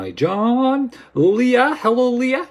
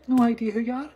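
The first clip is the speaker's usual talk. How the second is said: ordinary delivery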